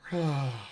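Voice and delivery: long open yawn on an out-breath; yawning sigh. Shown as a demonstration. Coach demonstrating a yawn for the class, open yawning sigh. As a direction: yawn it out while the tongue stays put. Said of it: "I want you to yawn".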